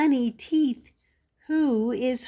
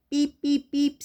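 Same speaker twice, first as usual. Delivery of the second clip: higher, bouncy truck beeps; Little Blue truck horn; 5 semitones higher, 3 dB louder than usual. Acted. Little Blue calling friends to Halloween, playful beeping. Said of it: "says Little Blue".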